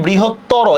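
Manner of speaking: বৃহত্তর ending on an open o, not on r; final vowel sounded. Shown as a demonstration. Teacher explains answer twenty-five, sounding the final o of বৃহত্তর. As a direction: say বৃহত্তর with the last o pronounced, 'brihottoro'. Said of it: "এখানে কিন্তু একটা অ আছে লাস্টে".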